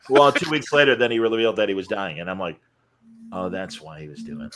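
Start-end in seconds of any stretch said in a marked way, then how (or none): 3.3-4.5 very soft, low aside; sheepish realization. Retold or imitated. Host learning the man was dying, quietly sheepish. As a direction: let it sink in under your breath.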